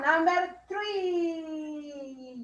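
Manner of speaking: Number three stretched very slow; very slow model. Shown as a demonstration. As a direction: drag each syllable out for the kids. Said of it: "Tiene que repetir". (muy lento, alargando cada sílaba)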